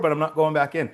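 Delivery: high-pitched, mildly acted protest; adamant refusal. Actor refusing crew another take inside, high firm refusal.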